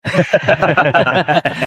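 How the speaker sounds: hearty host's laugh. Acted. Official welcomes the merchants' agents with a hearty laugh. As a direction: laugh to greet the guests. Host greets arriving guests.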